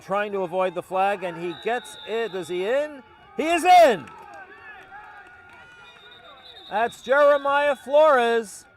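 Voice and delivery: rising to an excited shout; touchdown call. Real, not acted. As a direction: build up, then yell the touchdown. Play-by-play building to touchdown.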